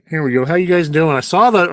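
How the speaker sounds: ordinary talk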